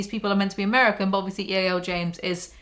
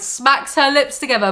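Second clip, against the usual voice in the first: louder, higher mock echo; incredulous repeat; 4 semitones higher, 5 dB louder than usual. Reader mocking the book's inner goddess line, incredulous. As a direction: throw the line back in disbelief.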